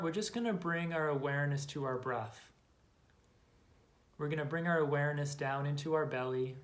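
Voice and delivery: calm, unhurried meditation guidance with long pauses; guided meditation. Acted. Guide to listeners closing their eyes to follow belly breaths, calm meditation voice. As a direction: lead them gently into the breath, leaving space after each phrase.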